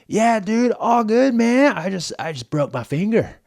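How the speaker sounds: mock California surfer dude, high and loud; California dude accent. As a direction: imitate a carefree California bro shrugging off a broken finger. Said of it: "super classic California accent".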